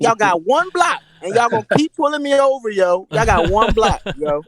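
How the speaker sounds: high-pitched comic exasperation; exasperated protest. Acted. Acted complaint to police pulling him over.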